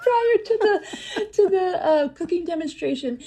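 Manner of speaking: high-pitched, real laugh through the words; laughing while talking. Real, not acted. Laughing at her showman story.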